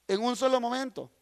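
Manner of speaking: ordinary talk, nothing marked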